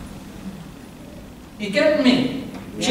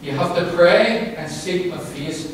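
normal talking voice

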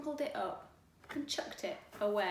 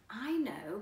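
ordinary delivery, nothing marked

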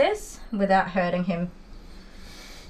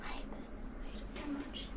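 whisper; 7 semitones higher, 19 dB quieter than usual. Real, not acted.

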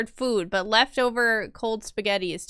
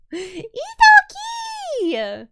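high, slow drawn-out call of a name; 6 dB louder, much slower than usual. Acted. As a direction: sing the name out long and high. Calling a name out dramatically.

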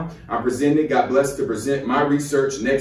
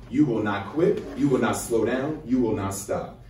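ordinary delivery

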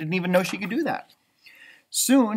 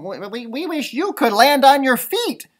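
high, louder bird voice, stammering; a small bird answering back; little bird voice; 8 semitones higher, 6 dB louder than usual. A narrator's reading. Flitter answers Stella Luna in a chirpy bird voice. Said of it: "Flitter replied".

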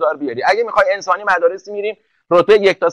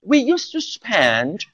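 mock fancy English accent, very drawn out; put-on English accent; 3 semitones higher, 5 dB quieter, much slower than usual. Teacher teasing girls who show off, affected English accent. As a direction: slowly overdo the English accent.

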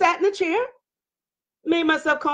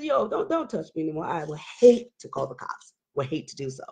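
low, soft, controlled voice; calm, quiet warning; 7 semitones lower, 6 dB quieter than usual. Retold or imitated. Wife telling husband not to touch her, quietly controlled. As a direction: keep it low and measured while warning. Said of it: "I was talking just like this".

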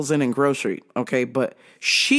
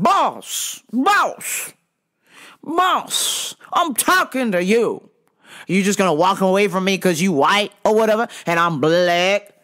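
aggressive customer; 3 semitones higher than usual. Retold or imitated. Customer confronting the coworker who walked away, aggressively. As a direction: imitate a pushy man who feels ignored. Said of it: "the attitude is very aggressive".

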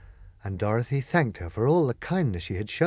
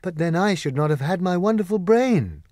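cried out; 6 semitones higher, 5 dB louder than usual. Acted. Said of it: "cried the Scarecrow".

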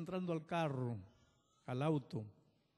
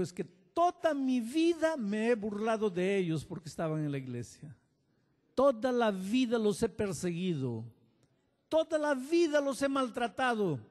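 building from raised to loud and higher; anguished confession; 9 semitones higher, 8 dB louder than usual. Retold or imitated. Old man confessing to pastor, building anguished cry. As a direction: pour out his guilt, louder each line.

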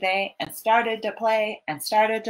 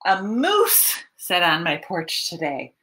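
plain reading voice

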